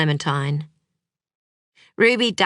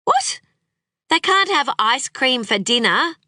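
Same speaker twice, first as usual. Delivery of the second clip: incredulous; 8 semitones higher than usual. Acted. Clementine stunned by ice cream offer.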